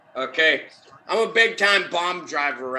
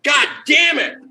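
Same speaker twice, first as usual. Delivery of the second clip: furious yell; angry shout; 13 semitones higher, 6 dB louder than usual. Real, not acted. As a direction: yell it out in frustration. Lost bet, angry outburst.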